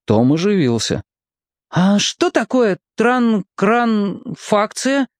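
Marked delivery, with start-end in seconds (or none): 1.7-5.1 slow puzzled stumble. A narrator's reading. Tom stumbles over word.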